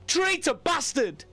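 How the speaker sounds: high slow shouted insult. Acted. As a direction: scream it in desperate rage. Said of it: "he shouted".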